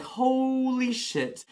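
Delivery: shocked. Retold or imitated. Realizes phone is stolen.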